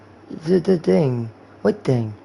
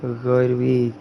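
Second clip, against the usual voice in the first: stoned guy. Pretend. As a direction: act high, dragging words and breaking into laughs. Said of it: "That's how you act when you're high".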